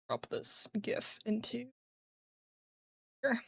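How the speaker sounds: soft breathy whisper. Real, not acted. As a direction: say it under your breath. Whispering while working.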